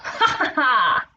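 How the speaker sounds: acted "ha ha ha" laugh; mischievous laugh. A narrator's reading. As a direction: laugh like a pleased trickster. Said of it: "The young wolf just laughed".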